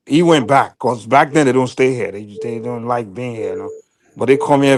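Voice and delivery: ordinary talk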